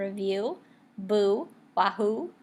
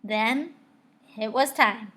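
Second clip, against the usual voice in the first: plain reading voice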